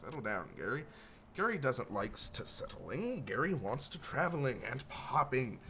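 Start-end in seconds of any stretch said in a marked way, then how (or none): 1.3-5.6 a higher goblin voice in broken grammar, stretching the words out slowly; rambling goblin. Acted. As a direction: babble it like a strange little goblin dreaming of travel. Gary asks to come along.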